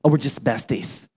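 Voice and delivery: lightly mocked teenage girl; teenage besties. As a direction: imitate a gushy teenage best friend. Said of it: "teenage girls who are besties".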